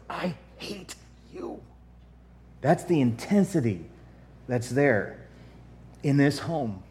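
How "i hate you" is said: whispered, slow and venomous; hateful whisper. Acted. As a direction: breathe the hatred out. Hatred ends in whisper.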